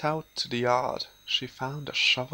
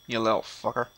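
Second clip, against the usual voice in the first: normal talking voice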